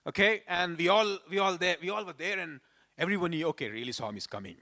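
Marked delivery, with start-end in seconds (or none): 3.5-4.6 lower, quieter and quick; hushed awe. Retold or imitated. Low hurried murmur of awe. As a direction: crowd whispering that Swami really comes, hushed and fast.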